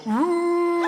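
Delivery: long, high, loud "roo" call; sustained hooting summons held on one high note; hilltop call. Acted. Comedian summoning fans from a hilltop, long high call. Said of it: "go to a hilltop and go".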